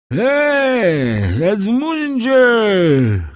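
very high, long drawn-out hail; shouting. A narrator's reading. Drunk Kaderus hailing Edmund from the arbour, high drawn-out shout. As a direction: holler it high, stretching each word out. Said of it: "krzyczał Kaderus".

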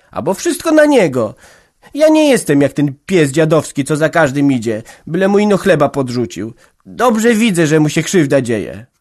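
high, loud defiant daughter; young woman defiant. A narrator's reading. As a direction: defend him against her mother. Daughter defending Antek. (Jagna broni się zaciekle)

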